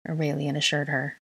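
plain reading voice

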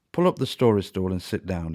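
usual speaking voice

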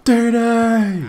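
long, high, loud holler; drawn-out cry falling at the end; yelled name. Real, not acted. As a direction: bellow the player's name. Yelling a player's name.

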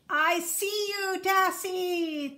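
high, slow screech; a predator gloating at its prey; screeching eagle. Acted. Eagle swooping down on the dassie, screeching. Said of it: "the eagle screeched".

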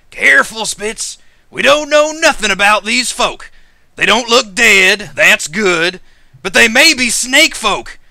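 high, loud lizard-folk rancher; loud, high-pitched country caution; wary rancher. Acted. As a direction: raise the voice in a folksy warning. Lizard rancher warns their companion.

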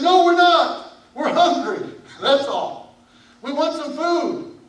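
protesting brothers. Acted.

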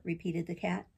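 usual speaking voice

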